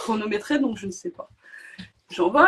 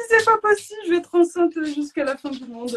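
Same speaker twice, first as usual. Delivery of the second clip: much higher and louder, fed-up wail; exasperated despair; 8 semitones higher, 5 dB louder than usual. Retold or imitated. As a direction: cry out that this will never end. Despair at the overdue pregnancy.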